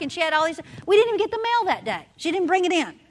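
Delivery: ordinary talk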